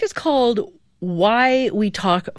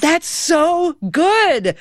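delighted; 10 semitones higher, much slower than usual. Real, not acted. Delighted after accurate guess.

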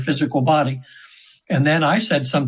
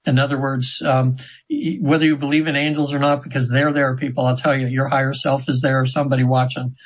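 ordinary delivery

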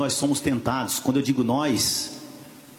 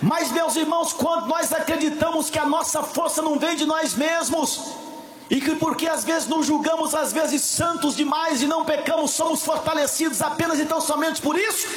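full-force preaching; 13 semitones higher than usual. Acted. Preacher rousing the church about Samson's strength, full-force preaching. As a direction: preach it at full volume, pressing on without letup.

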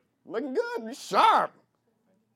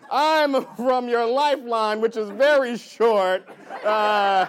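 loud, slow, stretched-out mock explaining; imitating Michiganders; 2 semitones higher, 6 dB louder, slower than usual. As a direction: draw it out loudly like giving hand-map directions. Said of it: "people from Michigan always, like, do this".

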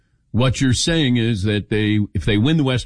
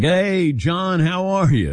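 higher, drawn-out hello; retold friendly greeting; 7 semitones higher, slower than usual. Host voicing Patrick greeting John, high friendly hello. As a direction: imitate a welcoming greeting, slowly.

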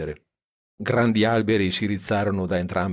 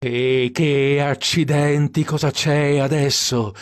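angry outburst; 5 semitones higher, 4 dB louder, slower than usual. A narrator's reading. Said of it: "si infuriò col cruscotto".

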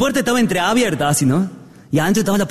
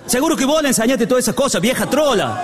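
higher-pitched comic husband's tirade; mock angry accusation; 7 semitones higher than usual. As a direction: lash out blaming the wife, hammed-up. Husband blames wife in quarrel. (acusación furiosa en broma)

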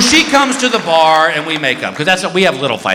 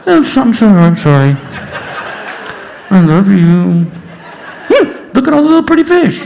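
muffled, louder apology into a snorkel; through a snorkel; 4 dB louder than usual. Retold or imitated. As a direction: mumble it through a snorkel tube. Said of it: "talking to her through the snorkel".

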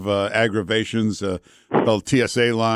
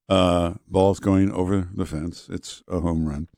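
low, slow pilot as baseball announcer; calm pilot; 4 semitones lower, slower than usual. Pretend. Host imagining a pilot calling baseball, deadpan calm. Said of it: "they could never work as, uh, baseball announcers".

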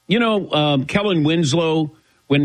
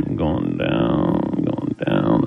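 much lower, very slow, drawn out; sinking faint; much slower than usual. Pretend. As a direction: let the voice sink and drag like fainting. Mock fainting, voice sinking away.

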